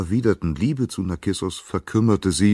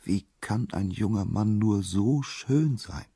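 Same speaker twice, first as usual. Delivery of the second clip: slow, higher-pitched wondering; sighed admiration; 6 semitones higher than usual. A narrator's reading. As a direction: sigh it in drawn-out wonder. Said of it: "hörte dieses Geflüster".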